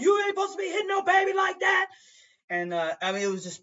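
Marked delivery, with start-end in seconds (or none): 0.0-1.9 high, fast shouted scolding; imitated furious bystander shout; lady going off. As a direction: yell at her like an outraged rider. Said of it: "some ladies was going off".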